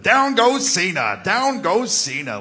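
announcer call. Retold or imitated. Edge ad-libbing over Raw footage, booming announcer call. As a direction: belt it like a ringside call. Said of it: "I gotta come up with it".

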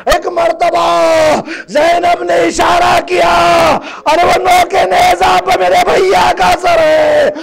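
high, impassioned grieving cry; full-force majlis preaching. Real, not acted. Preacher crying out the tragedy to the mourners. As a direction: preach it out, lamenting.

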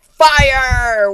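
gasp, then yell Fire! high and loud; shock-cry the word Fire; dramatic high yelled Fire; gasping shout. Retold or imitated.

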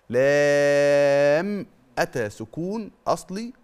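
لام with a long drawn-out aa, slow; recited letter name. Shown as a demonstration. Teacher demonstrates the letter madd. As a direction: stretch لام for six beats. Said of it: "ليس مشددا".

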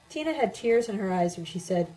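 plain reading voice

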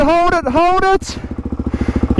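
slow, anxious hold it, hold it; drawn-out tense call. Real, not acted. Rider nearly falling.

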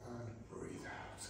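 slow, soft whisper on the exhale; whispered cue. Real, not acted. Instructor leading the class breath, slow whisper. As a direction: sink the voice into a whisper.

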